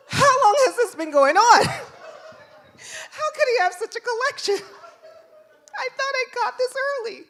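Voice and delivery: very high, loud, hammed-up disbelief; mortified mother. Mom seeing bag of stolen toys, squealed mock horror.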